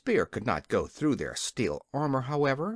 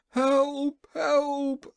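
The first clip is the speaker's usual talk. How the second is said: high, loud panicked scream; screaming for help; 12 semitones higher, 4 dB louder than usual. A narrator's reading. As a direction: shriek for help as the egg hits. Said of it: "screamed the King".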